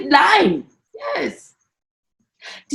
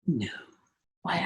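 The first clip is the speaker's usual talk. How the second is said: ordinary talk, nothing marked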